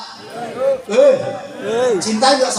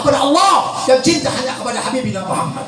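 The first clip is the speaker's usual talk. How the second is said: sustained, insistent sermon delivery; impassioned preaching; 4 semitones higher than usual. Acted. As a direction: exhort the congregation in rolling phrases. Preaches at full force. (ceramah penuh semangat)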